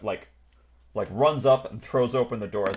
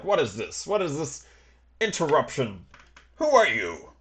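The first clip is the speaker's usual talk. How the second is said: mildly acted outrage, more affronted than scared; angry indignation; 3 semitones higher than usual. Passenger confronting his ambushers, more angry than afraid. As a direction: demand answers, a touch angry. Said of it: "he's more angry than afraid".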